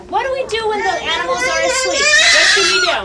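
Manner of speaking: put-on reading to a toddler. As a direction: wind down to bedtime. Putting toy animals to sleep.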